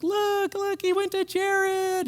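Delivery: high, slow, squealing show-off of the ring; mock giddy fiancée showing off a ring; excited gush. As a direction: gush like a newly engaged friend. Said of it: "She's so excited".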